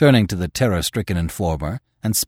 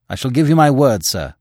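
stiffly; 6 semitones higher, 5 dB louder than usual. A narrator's reading. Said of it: "said the editor stiffly".

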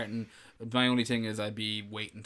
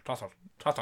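"Trossard" in a rural Irish bogger accent; short, countrified Irish "Trossard", stress up front; faster than usual. Shown as a demonstration. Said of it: "say it like a bogger".

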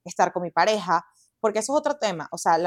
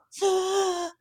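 high, mock-shocked gasp; 10 semitones higher than usual. Mother retelling her reaction to the neighbour's blunt words, imitated shocked gasp. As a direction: gasp as if just hit by hard truth. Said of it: "Y yo".

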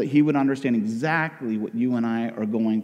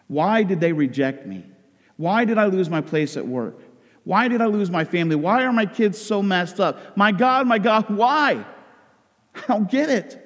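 anguished questioning; 6 semitones higher than usual. Acted. Rejected believer asking God why, building anguish. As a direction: pile up the whys, rising into desperation.

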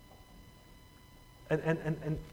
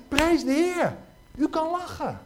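much higher, loud delighted cry; joyful exclamation; 12 semitones higher, 4 dB louder than usual. Retold or imitated. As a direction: burst out in happy praise. Joyful reaction to woman laughing.

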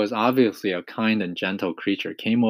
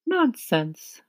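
high-pitched character voice; dismissive ladybug. A narrator's reading. Ladybug brushing off the earthworm's gloom, high voice.

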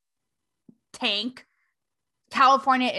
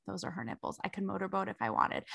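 hushed, dazed inner voice; checked-out inner thought; 4 semitones lower, 13 dB quieter than usual. Retold or imitated. Reporter hushed staring at the boobs, dazed murmur. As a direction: murmur it like a private thought, much softer. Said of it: "checked out being like".

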